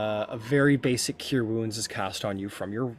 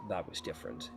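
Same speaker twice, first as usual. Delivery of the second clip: sullen sea god; 5 semitones lower, 12 dB quieter, faster than usual. Acted. GM voices Poseidon.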